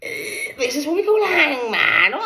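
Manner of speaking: high, loud laughing shout. Real, not acted. Gloating at the doctor, a high laughing shout. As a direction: crow with laughter.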